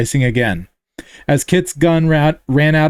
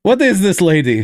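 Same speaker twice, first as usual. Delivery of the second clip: put-on higher heavy Russian accent; thick Russian accent; 4 semitones higher than usual. Hammer confronts the reporter, in a Russian accent. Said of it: "his thick Russian accent".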